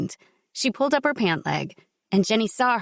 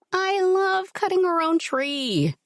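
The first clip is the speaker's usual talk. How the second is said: woman character voice; 11 semitones higher, slower than usual. Acted.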